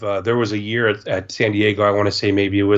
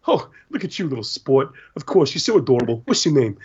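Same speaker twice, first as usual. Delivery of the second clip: lightly voiced kindly adult cooing at a child; Dan; 3 semitones higher than usual. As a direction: imitate someone indulging a young fan. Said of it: "Dan's like".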